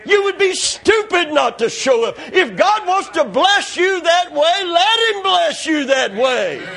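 high, exclaiming preacher voice; drawn-out, high-pitched exhortation; fervent preaching. Real, not acted. Preacher urging congregation to accept God's blessing, high fervent preaching.

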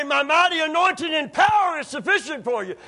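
high, loud mock proclamation; booming mock declaration of power; mock boast. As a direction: shout the wrong version grandly. Mock wrong version, shouted.

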